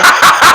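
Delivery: loud burst of laughter; real loud cackling laugh. Real, not acted. Laughs at the other's remark.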